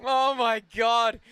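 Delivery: high laughing fit. Real, not acted. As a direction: laugh through it, out of control.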